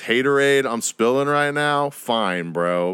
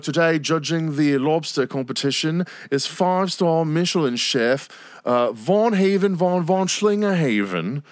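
higher, grand announcer voice, slowing on the name; mock show host; 4 semitones higher than usual. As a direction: announce the guest chef like a TV host. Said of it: "he'll be like".

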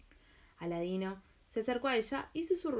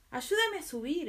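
calling up for help; urgent plea from below; exclaiming; 7 semitones higher, 4 dB louder, slower than usual. Acted. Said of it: "exclamó Aladino".

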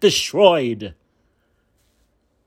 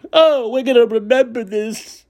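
ordinary talk, nothing marked

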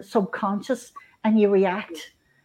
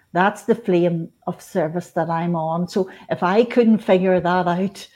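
ordinary talk, nothing marked

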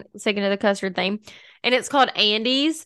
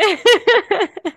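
high laugh; 10 semitones higher, 3 dB louder than usual. Real, not acted. Friends laughing at the joke.

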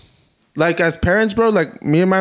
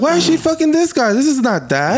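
much higher, squeaky mock outrage; imitating shocked kid; 10 semitones higher than usual. Kid reacting to mom's new man, mock-outraged. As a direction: put on a high, scandalized child's voice. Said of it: "She goes on like".

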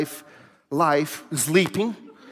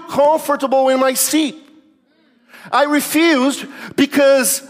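higher, declaiming mock sermon; impassioned mock preaching; 6 semitones higher, 3 dB louder than usual. Man confesses refusing the upgrade, mock-preaching delivery. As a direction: proclaim each refusal like a preacher.

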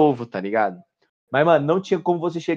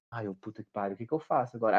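hushed; 6 semitones lower, 14 dB quieter than usual. Retold or imitated. Panicked thought hiding in shower.

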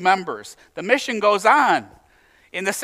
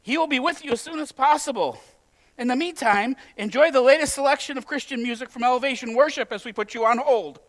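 higher, singsong customer-service voice; hotline operator; 5 semitones higher than usual. Acted. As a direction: recite it like a switchboard script. Operator puts caller on hold.

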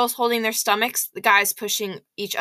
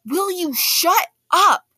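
high, slow blurted snap; louder than meant; 10 semitones higher, 4 dB louder, much slower than usual. Acted. Boy snapping at a snickering classmate during the lecture, too loud. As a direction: outburst that comes out too loud. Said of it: "It came out louder than I meant to".

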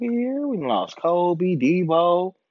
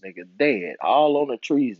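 ordinary talk, nothing marked

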